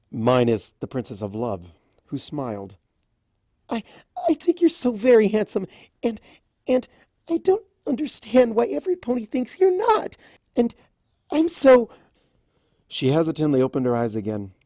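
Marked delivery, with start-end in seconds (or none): 3.7-11.9 much higher, fast tearful rush; tearful gush. A narrator's reading. Fluttershy gushing to Discord through tears. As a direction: pour it out in tears. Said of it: "her face streaked with tears".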